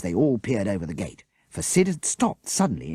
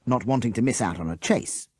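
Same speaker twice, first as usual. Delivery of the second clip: ordinary delivery, nothing marked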